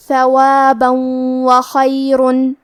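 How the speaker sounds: slow chant, end of ثوابا hummed into a doubled waw of وخیرن; nasalized merging. Shown as a demonstration. Teacher shows idgham, slow chant with nasal waw joining ثوابا وخیرن. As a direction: recite ثوابا وخیرن as "thawabaw-wakhayrun" with nasal hold. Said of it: "غنہ اور تشدید کے ساتھ".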